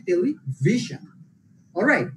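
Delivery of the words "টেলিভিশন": টেলিভিশন drawn out with ভি punched; stress on VI. Shown as a demonstration. Teacher explaining where to emphasize, টেলিভিশন stressed on ভি. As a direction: slowly, hit ভি in টেলিভিশন. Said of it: "emphasize on".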